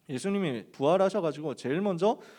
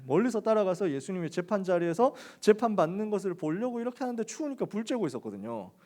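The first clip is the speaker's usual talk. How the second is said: normal talking voice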